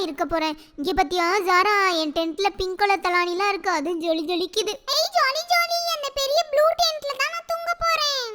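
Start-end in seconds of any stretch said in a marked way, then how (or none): 5.0-8.2 high, bouncy child voice, lightly voiced; excited kid. Little girl claiming the blue tent, gleeful high voice. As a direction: squeal with delight about the big tent.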